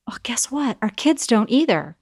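whispered aside. Real, not acted. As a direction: lean in and whisper it. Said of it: "whispered point".

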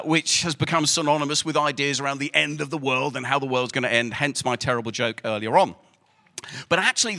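ordinary talk, nothing marked

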